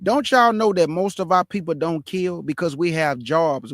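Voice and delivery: ordinary talk, nothing marked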